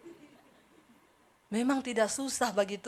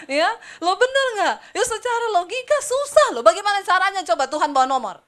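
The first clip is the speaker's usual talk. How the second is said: high, loud rhetorical push; preacher challenging the crowd, loud and high; loud, high challenge; 7 semitones higher, 9 dB louder than usual. Real, not acted. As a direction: pitch it up and press them. Pressing congregation loudly.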